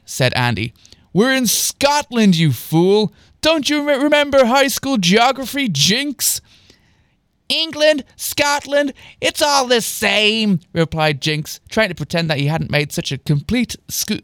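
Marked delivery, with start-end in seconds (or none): 1.1-6.4 high, incredulous scolding; mocking correction. A narrator's reading. Andy mocks friend's geography error. 7.5-10.6 high, drawn-out brush-off; airy dismissal. A narrator's reading. Jinx brushes off his error.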